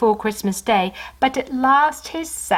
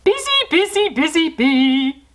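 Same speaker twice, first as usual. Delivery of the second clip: loud, much higher sing-song roar; 8 semitones higher, 4 dB louder than usual. Acted. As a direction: bellow it out with glee. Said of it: "roared Santa".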